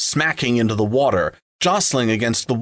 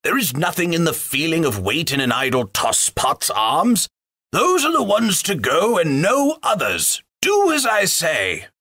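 bossy butler; 6 semitones higher than usual. A narrator's reading. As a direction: order them about. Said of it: "growled the butler".

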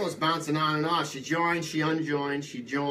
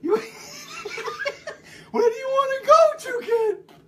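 very high-pitched comic local voice; high character voice, on the edge of laughing; Fall River high-pitch guy; 3 dB louder than usual. Acted. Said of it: "Fall River High Pitch guy".

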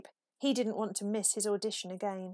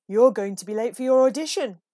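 louder, calling across the trees; 11 dB louder, faster than usual. A narrator's reading. Owl calling out loud to wake sleepy Peep. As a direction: call out to wake him. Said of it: "called Owl".

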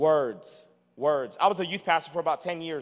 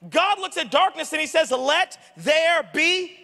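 high and loud, driving every point home; full-force preaching; 10 semitones higher, 5 dB louder than usual. Acted. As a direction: preach it to the back row.

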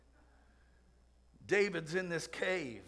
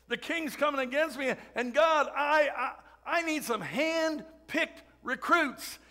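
pleading David; 4 semitones higher, 3 dB louder than usual. Retold or imitated. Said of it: "What an army".

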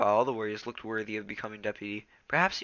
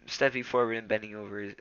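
ordinary delivery, nothing marked

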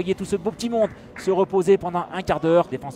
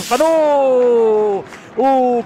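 excited sports commentary; 6 dB louder, much slower than usual. Real, not acted. Near-goal chance.

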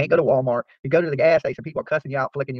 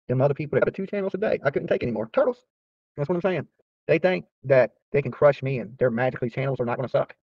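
ordinary talk

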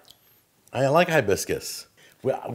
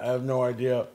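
usual speaking voice

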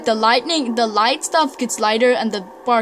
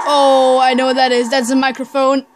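louder, eager "oh, I know"; excited recognition; 6 dB louder than usual. Real, not acted. Gamer spotting the microphone in the cutscene, loud and excited. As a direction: blurt it out as you spot it.

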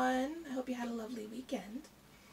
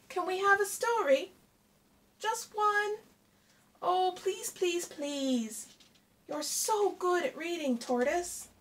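higher, louder wheedling plea; begging Hare voice; 7 semitones higher, 5 dB louder than usual. Acted. Hare begging Tortoise for a bedtime story, higher and pleading. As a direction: beg sweetly for one more story.

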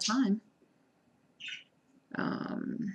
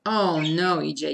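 ordinary delivery

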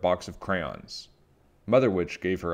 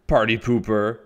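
loud, grouchy heckle; shrieking; 3 semitones higher, 8 dB louder than usual. A narrator's reading. Aunt Grouchy scolds Little Witch for spoiling her fun, shrieking. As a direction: throw it out as a loud jeer. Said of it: "shrieked Aunt Grouchy".

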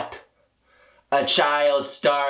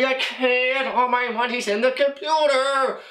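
whiny-style helpless excuse, pitched up; protesting excuse; 6 semitones higher than usual. Acted. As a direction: plead it at a raised pitch. Pleading excuse to scolding librarian.

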